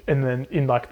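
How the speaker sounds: ordinary talk, nothing marked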